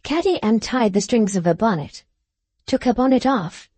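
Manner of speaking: ordinary delivery, nothing marked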